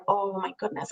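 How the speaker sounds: soft, breathy-free hushed gasp of words; hushed dismay. Retold or imitated. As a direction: say it under your breath, overwhelmed. Inner thought on walking in.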